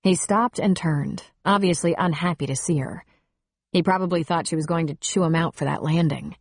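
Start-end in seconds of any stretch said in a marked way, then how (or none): none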